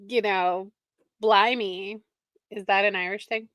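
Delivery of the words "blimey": ordinary delivery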